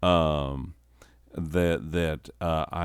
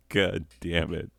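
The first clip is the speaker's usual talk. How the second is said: ordinary talk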